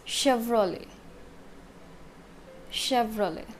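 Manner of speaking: Chevrolet as "SHEV-ro-lay"; silent ET. Shown as a demonstration. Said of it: "E T is also silent".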